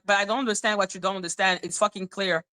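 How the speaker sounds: fast, irritated condescending tone; irritated rant. Retold or imitated. Explaining her annoyance to viewers, fast and condescending. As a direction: rattle it off impatiently. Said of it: "when I'm like".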